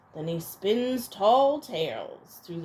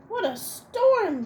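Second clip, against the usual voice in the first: much higher, chirpy little bird voice; twittering wren; 11 semitones higher, 4 dB louder than usual. Acted. Wren twitters about the storm to the animals, high bird voice. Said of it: "twitters wren".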